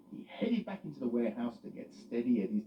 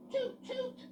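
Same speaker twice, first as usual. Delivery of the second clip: very high tooted horn sound; tooting horn. Acted. Freddie sounding his horn, high tooting. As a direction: toot like a little horn. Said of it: "went Freddie".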